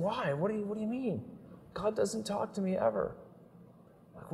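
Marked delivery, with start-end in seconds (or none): 0.0-3.1 put-on higher, softer voice of a little boy; a hint of a small boy, hushed; gently voiced child. Father and son dialogue voiced.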